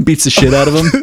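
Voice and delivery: ordinary talk, nothing marked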